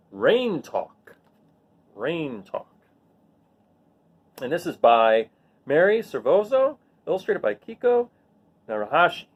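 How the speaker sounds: normal talking voice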